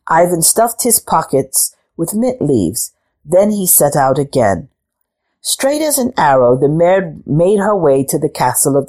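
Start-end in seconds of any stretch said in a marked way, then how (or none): none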